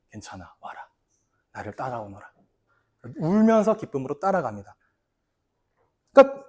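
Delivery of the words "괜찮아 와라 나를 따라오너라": very soft, low, gentle call; tender invitation. Retold or imitated. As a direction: invite him gently to follow. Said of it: "예수님이". (예수가 베드로를 다정하고 아주 낮게 부름)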